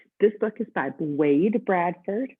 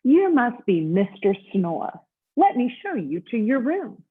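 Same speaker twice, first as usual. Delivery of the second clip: bright, slow, higher hotel bellhop greeting; 5 semitones higher, 5 dB louder, slower than usual. Acted. Bellhop welcoming Mister Snore, high and cheery. Bellhop shows guest to room.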